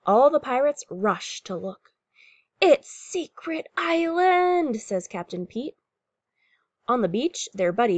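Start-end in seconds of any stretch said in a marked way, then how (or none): 2.6-4.8 high, slow, drawn-out exclamation; storybook wonder, stretched out high; captain's excited announcement. A narrator's reading. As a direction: announce a thrilling discovery.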